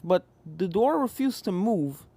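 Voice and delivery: ordinary delivery, nothing marked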